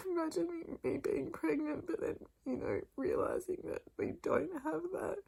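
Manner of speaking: tearful, voice high and breaking; crying. Real, not acted. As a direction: speak through real tears. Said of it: "I just feel so emotional".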